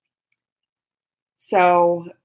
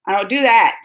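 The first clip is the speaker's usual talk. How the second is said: ordinary delivery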